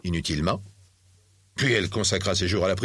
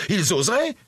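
high, incredulous outrage; acted indignant question, pitched way up; 10 semitones higher than usual. A narrator's reading. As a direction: exclaim as if deeply affronted. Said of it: "devint pourpre d'indignation".

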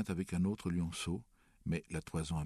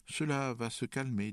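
uneasy reassurance, pitched up; mal at ease; 8 semitones higher, 3 dB louder than usual. A narrator's reading. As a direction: try to reassure while ill at ease. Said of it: "dit Père mal à l'aise". (rassurant mais mal à l'aise)

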